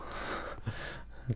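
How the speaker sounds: quiet unvoiced chuckle; breathy laugh mid-sentence; soft laugh. Real, not acted. Reader amused at the armed greeting, quiet chuckle. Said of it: "You're pointing guns at people".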